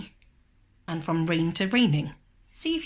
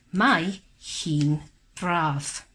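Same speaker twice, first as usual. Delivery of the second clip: slow read-along; 3 semitones lower, much slower than usual. Shown as a demonstration. Reading sentence slowly.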